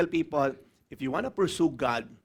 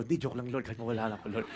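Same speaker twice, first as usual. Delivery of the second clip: softer, lower, laughing a little; sheepish take-back; 8 semitones lower, 9 dB quieter, faster than usual. Retold or imitated. Pastor sheepishly taking back his prayer to God, soft and giggly. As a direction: backpedal quietly, half laughing.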